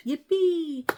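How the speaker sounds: high, loud yippee; excited cheer. Real, not acted. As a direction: squeal it with glee. Cheer at day off.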